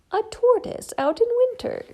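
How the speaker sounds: high chirpy little bird voice; cheeping bird. Acted. Bird teasing Shelley on the hill, high cheep. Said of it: "cheeped the bird".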